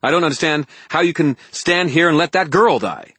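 loud, fast, angry outpouring; heated tirade, building at the end; snapped. A narrator's reading. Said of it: "I snapped".

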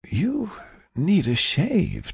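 slow, high, halting voice; weak, affectionate patient. Acted. Patient touches friend's beard, slow frail teasing.

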